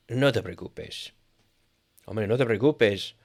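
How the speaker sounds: ordinary delivery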